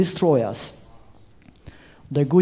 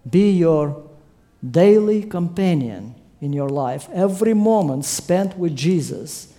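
ordinary delivery

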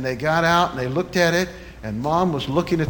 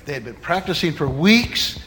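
ordinary talk

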